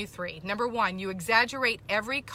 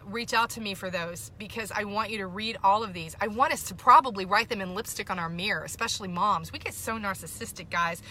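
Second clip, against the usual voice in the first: ordinary talk, nothing marked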